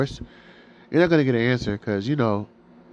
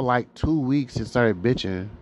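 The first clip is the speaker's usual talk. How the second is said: ordinary talk, nothing marked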